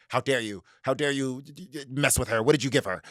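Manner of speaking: a touch of hammed-up outrage, stammering; mock outrage. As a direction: play the offended family, mildly. Family angrily confronts heroine.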